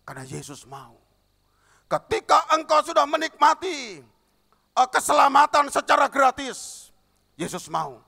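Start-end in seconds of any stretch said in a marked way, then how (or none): 1.9-8.0 high, very loud sermon shout; preaching at full force. Acted. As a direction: drive the point home at full volume. Sermon build, preaching loudly. (berkhotbah dengan suara keras dan tinggi)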